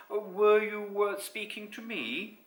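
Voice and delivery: courteous high character voice; not at all monstrous. A narrator's reading. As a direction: ask politely with a little stumble. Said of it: "voice that was not at all monstrous".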